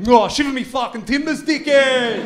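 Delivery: a hammed-up pirate calling someone dickhead; Greek pirate. Greek pirate yelling at a stranger on his boat, mock pirate voice. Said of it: "there were Greek pirates".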